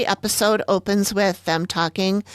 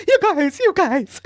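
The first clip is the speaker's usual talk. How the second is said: frightened scaredy cat in a cellar; scared whimper; 14 semitones higher than usual. Retold or imitated. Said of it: "and then he's like".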